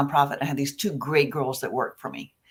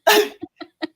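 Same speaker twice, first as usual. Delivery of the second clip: real high burst of laughter; 14 semitones higher, 6 dB louder than usual. Real, not acted. Laughing at her own cookie story, high burst of laughter. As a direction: laugh at the memory.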